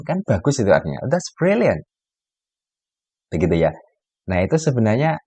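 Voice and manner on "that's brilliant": "brilliant" pitched high and falling, ironic; sarcastic praise. Shown as a demonstration. Teacher acting the sarcastic native speaker. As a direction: say it sweetly while meaning the opposite.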